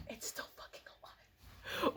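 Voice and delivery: whispered in disgust at the bug; horrified whisper. Real, not acted. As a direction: hiss it in shock, barely voiced. Bug still alive, whispered horror.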